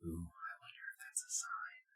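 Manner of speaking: hushed fast whisper; worried whisper. Real, not acted. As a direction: whisper quickly, conspiratorial. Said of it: "Why are we whispering?".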